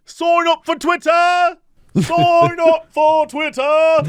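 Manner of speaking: high, slow calling out; street hawker shout. Pretend. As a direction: hawk it loudly like a peddler.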